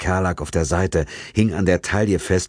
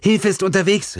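mild call downward, higher female voice; 14 semitones higher, 3 dB louder than usual. A narrator's reading. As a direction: call down into the shaft, lightly raised. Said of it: "rief Sex nach unten".

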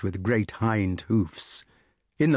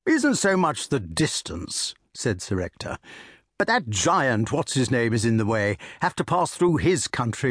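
jovial country squire offering port; bluff old squire. Acted. Sir Ector voiced in story.

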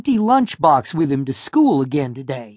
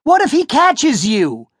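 calling back; 8 semitones higher than usual. A narrator's reading. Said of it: "Noah called back".

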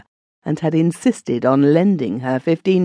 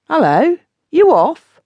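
much higher put-on voice, teasing; cheeky groom; 13 semitones higher, 5 dB louder than usual. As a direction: toss it off lightly, flirting back. Groom answers Minnie.

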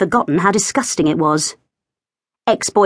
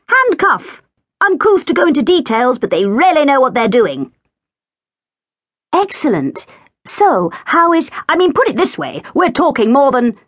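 higher, self-satisfied man's voice; pompous Hugh; 7 semitones higher than usual. A narrator's reading. Hugh showing off his bonus to Katy, pompous. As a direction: play him pleased with his own cleverness.

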